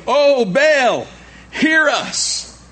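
high, loud mock invocation; calling on Baal. Worshippers begging their sun god to answer, high calling-out. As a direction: cry out to a god, drawn high. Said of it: "they called on the name of Baal".

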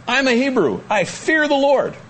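high, ringing profession of faith; bold declaration. Acted. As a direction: declare it proudly, drawing out the end. Prophet boldly declaring his faith.

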